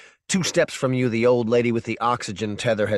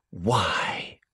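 a lone unvoiced whisper; whispered question; 3 semitones lower, 4 dB quieter than usual. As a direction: breathe the word out, no voice at all. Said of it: "she asked you once".

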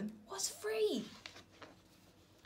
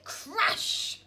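loud higher crash word; 9 semitones higher, 4 dB louder than usual. A narrator's reading. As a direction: smash it out for the kids. Norman crashes the slide.

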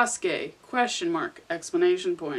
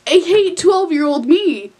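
louder, higher and exasperated; loud groan; 6 semitones higher, 8 dB louder than usual. Real, not acted. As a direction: groan at your younger self. Loud embarrassed outburst.

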